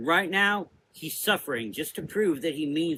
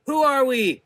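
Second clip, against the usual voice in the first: much higher, louder rallying call; 12 semitones higher, 9 dB louder than usual. A narrator's reading. Prudence rallying the sisters, loud call. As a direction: rouse the troops.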